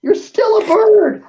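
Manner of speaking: high, slow screaming demands; screaming at the bird. Acted. DM screaming at the stubborn bird to jump off. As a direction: shriek at them to jump.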